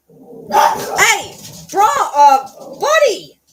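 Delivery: high, loud call to a dog; sing-song call across the room. Real, not acted. Calling to her restless dog, high loud call.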